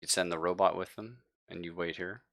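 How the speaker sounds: normal talking voice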